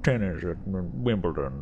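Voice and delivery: hammed-up rich captain voice; snobby yacht captain. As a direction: play a self-satisfied yacht owner. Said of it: "the captain's up here like".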